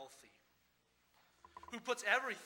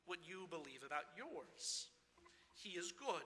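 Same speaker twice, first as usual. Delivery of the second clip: ordinary talk, nothing marked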